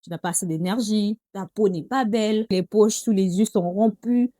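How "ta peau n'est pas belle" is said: normal talking voice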